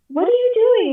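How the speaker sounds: high-pitched, puzzled question, acted out; incredulous wife. Wife catching husband at the window, incredulous.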